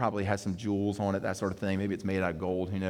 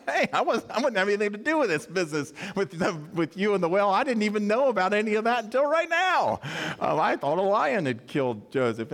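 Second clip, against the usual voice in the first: put-on much higher, carefree child voice; innocent little brother; 8 semitones higher than usual. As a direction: squeak it out like a clueless kid brother. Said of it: "Benjamin, he wasn't worried".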